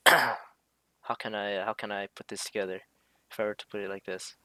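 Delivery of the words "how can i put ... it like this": ordinary talk, nothing marked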